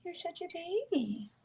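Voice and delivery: high soft cooing at a pet. Real, not acted. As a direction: coo it gently up high. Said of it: "talk like that to me".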